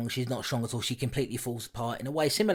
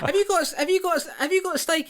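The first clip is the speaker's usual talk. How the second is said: much higher and louder, teasing disbelief; mock incredulous; 7 dB louder than usual. Teasing Tom for defending the movie, mock incredulous. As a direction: accuse him in playful astonishment.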